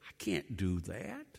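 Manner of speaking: low, soft reluctant Gideon; a hint of a fearful man; timid refusal. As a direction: shrink back from the call.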